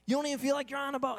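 mock eager cruise fan; insistent friends. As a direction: rush to reassure a doubter. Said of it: "And everyone's like".

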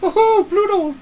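high squeaky cartoon mouse cry; Mickey Mouse. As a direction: call out like a cheery cartoon mouse. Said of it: "you sounded more like Mickey Mouse".

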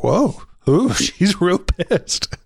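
high-pitched, drawn-out "whoa"; playfully impressed, voice lifted high; mock startled reaction. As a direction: react as if taken aback, pitch jumping up. Re-enacting own startled reaction to scene.

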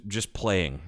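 plain reading voice

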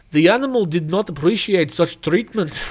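put-on a clumsy try at a Russian accent, reading the quote; half-hearted Russian accent; mock Russian accent. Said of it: "I can't do a Russian accent".

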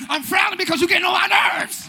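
high, loud mock exasperation; exasperated outburst. Spouse fed up after being accused of attitude, exasperated shout. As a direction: blow up at the end of the bickering. Acted squabble boils over.

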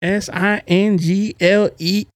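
letter by letter, slow; spelled out slowly. Shown as a demonstration.

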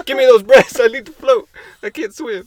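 high, loud desperate plea; excited pleading. Pretend.

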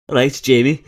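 mock Geordie greeting, loud and bright; hammed-up Geordie, calling out cheerily; poor Geordie accent. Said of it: "a really poor Geordie accent".

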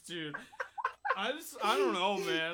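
mock-sad drunk friend with a laugh in it. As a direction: whimper like a lost drunk who gives up. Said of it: "He's so sad".

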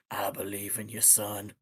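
low, soft echo of a memory; Jack's voice. Retold or imitated. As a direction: murmur it like a voice in your head. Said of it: "you just hear Jack's voice".

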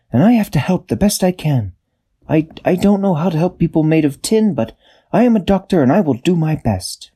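high, earnest doctor character; a small brave helper voice; Quasi's voice. A narrator's reading. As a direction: Quasi offering earnestly to help.

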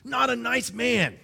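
mock complainer. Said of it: "I hear people say".

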